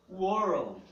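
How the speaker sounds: "World" loud, with a curled R before the L; world demo. Shown as a demonstration. Teacher says "World" loudly for students. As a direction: stress the R in "World". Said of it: "muito difícil de ser pronunciada".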